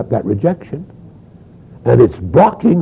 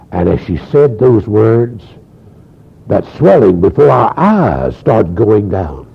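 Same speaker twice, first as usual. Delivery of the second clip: ordinary talk, nothing marked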